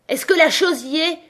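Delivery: high, forceful demand; roared question. A narrator's reading. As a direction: roar the question up at him. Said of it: "rugit Pied".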